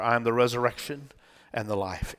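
low, soft reading of the verse; quiet scripture reading. Acted. Preacher reading Jesus' words to Martha, quiet scripture reading. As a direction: read it gently, well below preaching pitch.

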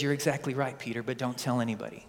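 soft, low, confiding aside; a quiet word to Peter, hushed; hushed Jesus. Retold or imitated. Jesus voiced hushing Peter.

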